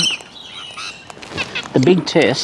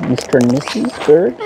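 normal talking voice

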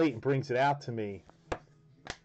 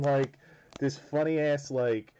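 ordinary talk, nothing marked

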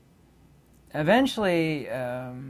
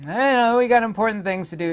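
much higher, louder, fast mocking cop voice; sarcastic cop; 10 semitones higher, 7 dB louder than usual. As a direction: whine it out like a put-upon officer. Mimicking dismissive police.

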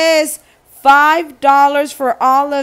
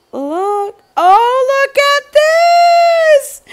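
much higher, louder and slow, stretched squeal; delighted squeal; 14 semitones higher, 4 dB louder, slower than usual. Real, not acted. Showing viewers the bag, high drawn-out squeal. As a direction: swoon over it like a gift.

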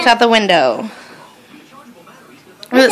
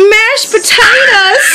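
gasping in disgusted shock; gasp, then blurt it out; 8 semitones higher, slower than usual. Real, not acted. Gasping at gross ear.

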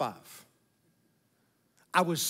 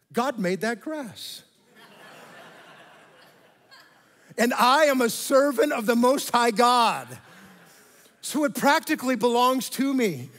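higher, louder, smug reasoning; smug self-justifying; 5 semitones higher, 4 dB louder than usual. Retold or imitated. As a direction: play the proud servant of God, hammed-up. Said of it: "I start thinking to myself".

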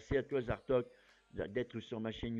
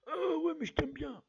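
squeaky high put-on voice of his other self; high playful alter ego; high future-self voice; 14 semitones higher, 5 dB louder than usual. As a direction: answer yourself in a high cartoon voice.

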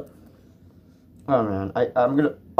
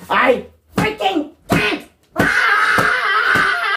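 real frustrated shout; much slower than usual. Real, not acted. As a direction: let it burst out with a yell. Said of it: "I can't even talk correctly".

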